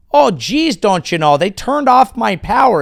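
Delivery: high, loud folksy Minnesota voice; hammed-up Upper Midwest "oh geez"; Minnesota accent. Comic mock Minnesotan complaining about power cut.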